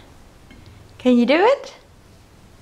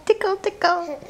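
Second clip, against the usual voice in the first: giggly tickle attack; 4 semitones higher than usual. Real, not acted. Tickling baby, laughing.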